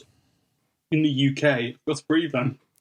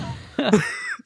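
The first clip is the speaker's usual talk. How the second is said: very high squeaky laugh; high squeal laugh. Real, not acted. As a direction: let out a squealing giggle. Laughing at the song mix-up.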